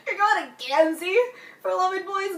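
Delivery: incredulous question broken by a laugh; laughing disbelief. Real, not acted. Fan laughing at Adam seeking love advice, laughing disbelief. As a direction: laugh at him as you ask. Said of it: "I'm like".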